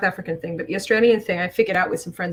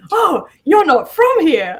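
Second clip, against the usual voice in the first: high, loud, drawn-out stranger's surprise; mock amazement; 10 semitones higher, 10 dB louder, slower than usual. Someone discovering she is foreign, imitated amazement. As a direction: stretch it out in exaggerated discovery. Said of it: "someone tell me that before".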